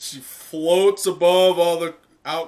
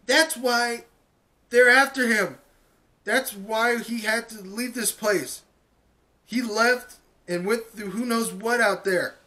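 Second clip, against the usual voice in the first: put-on much higher, pressing girl's voice; Uraraka urging; 7 semitones higher than usual. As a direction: press the point home. Uraraka continues her speech.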